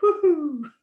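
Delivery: high owl hoot with a laugh. Shown as a demonstration. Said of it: "So you can try that yourselves".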